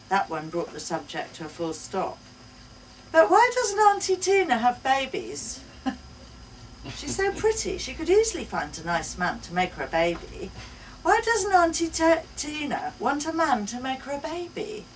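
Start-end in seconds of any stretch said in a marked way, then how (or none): 3.1-14.9 put-on high, loud child's voice, much higher; little girl questioning. A narrator's reading. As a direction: wonder aloud like a small girl.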